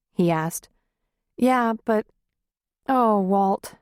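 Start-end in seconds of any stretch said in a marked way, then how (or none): 1.4-3.8 tearful. A narrator's reading. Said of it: "she cried".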